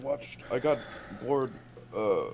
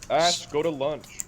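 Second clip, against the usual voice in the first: louder, slow call; 6 semitones higher, 7 dB louder, slower than usual. Real, not acted. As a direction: call across the room, slowly. Calling out to friend.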